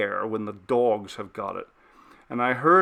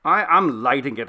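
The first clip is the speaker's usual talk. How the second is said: savagely; 3 semitones higher, 6 dB louder than usual. Acted. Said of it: "I said savagely".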